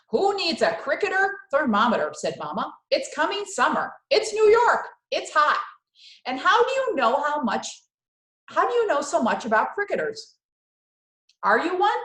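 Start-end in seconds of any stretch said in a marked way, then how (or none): 0.1-2.1 loud, higher scolding mother; scolding Mama. Acted. Mama scolding Mario over the newsstand, loud and high. Mama mocks cricket thermometer. 2.9-12.0 loud, much higher mocking mother; exasperated Mama. Mama scoffing at Mario's cricket knowledge, loud and high. Mama voiced in read story.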